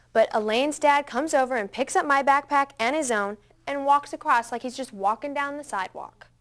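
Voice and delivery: ordinary delivery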